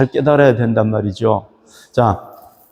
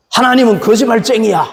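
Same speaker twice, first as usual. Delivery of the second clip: mocking sneer; 8 semitones higher than usual. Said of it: "이러면서".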